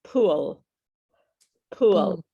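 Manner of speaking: "pool" ending on a full l, tongue forward; tongue-out final L. Shown as a demonstration. Teacher drilling student on tongue position, emphatic final L. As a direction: push the tongue out to finish "pool". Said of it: "your tongue has to go out".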